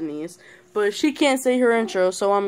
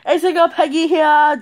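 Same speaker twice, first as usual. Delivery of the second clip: high, loud hammed-up intro voice; mock YouTuber intro; 9 semitones higher, 8 dB louder than usual. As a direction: parody a chirpy channel greeting.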